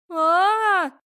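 loud, high drawn-out shriek; frightened scream. Acted. Heroine walks into a room of skeletons, frightened scream.

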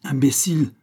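exclaimed outburst. A narrator's reading. Exalted priest cries out at Pitou, an exclaimed outburst. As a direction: burst out with the insult. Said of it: "s'écria l'abbé Fortier".

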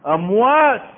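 loud, high "اموات" thrown out; said with full mouth. Real, not acted. Preacher denounces the rulers, loud on "اموات". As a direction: declare "اموات" boldly for all to hear. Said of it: "بقولها بملء فيه".

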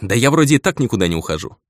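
plain reading voice

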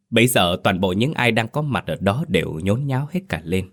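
ordinary delivery, nothing marked

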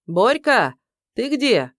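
loud, high booming shout across the house; calling her ex down from upstairs, booming. Acted. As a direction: call out loudly through the whole house. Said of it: "закричала зычным голосом".

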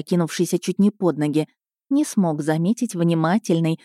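plain reading voice

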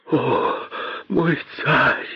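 soft, high moan; moaning plea. A narrator's reading. Poor Косой appeals to his king, moaning. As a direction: groan it weakly and beseechingly. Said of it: "простонал бедный Косой".

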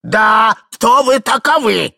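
loud, indignant shout; husband chiming in. Acted. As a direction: shout the demand, backing up the wife. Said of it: "подхватил муж". (громкий возмущённый окрик мужа)

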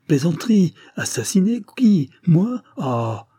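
high, loud, broken-up protest; strangled panic. A narrator's reading. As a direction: fire off stunned questions, high and choked. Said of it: "d'une voix étranglée". (voix étranglée, aiguë, affolée)

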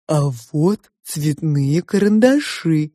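slow, drawn-out character voice; probing two-faced Sofya. A narrator's reading. Sofya questions the girl about her parents, probing. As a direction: probe slowly, stretching the words.

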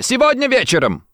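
high, panicked exclamation; frightened cry. A narrator's reading. Rossetti gives up the time, crying out frightened. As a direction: blurt it out, pitch leaping. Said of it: "закричал он испуганно".

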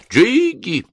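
high shrill bird cry; parrot squawk. A narrator's reading. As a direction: blurt it like a parrot. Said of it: "и вдруг вырвался". (вскрик попугая)

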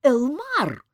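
high, loud, slow outraged yell. A narrator's reading. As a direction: scream at him in shock. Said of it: "заорала Ольга".

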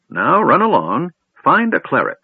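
put-on high, loud shooing old voice; dismissive old man. Old man sending the kender away to find a cleric, high and loud. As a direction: wave the boy off kindly but briskly.

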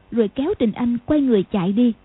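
ordinary delivery, nothing marked

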